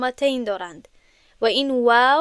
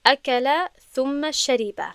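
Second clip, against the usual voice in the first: اکل ثم شریب read slowly and deliberately; slow Arabic example; 3 semitones higher, slower than usual. Shown as a demonstration. Teacher giving students an Arabic example slowly. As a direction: read the example clearly, word by word. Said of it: "مثال".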